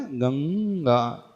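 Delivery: गंगा very slowly, long humming ng; गंगा with throat and nose ng held long; drawn-out anusvara. Shown as a demonstration. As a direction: stretch the nasal ng in गंगा. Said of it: "जैसे गंगा पढ़ते हैं ना".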